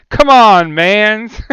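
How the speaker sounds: loud, high mock "Come on, mans!" outburst; hammed-up exasperated gamer shout; mock frustrated player. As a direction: yell it like a player whining at a bad draw. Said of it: "any of the".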